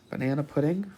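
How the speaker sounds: usual speaking voice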